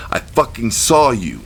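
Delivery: menacing threat. A narrator's reading. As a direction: spit it out slowly, ready to fight. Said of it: "I said".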